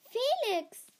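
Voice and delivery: high, loud exclamation; excited shout of recognition; surprised greeting. A narrator's reading. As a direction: call the name in delighted surprise. Delighted surprise at seeing a friend.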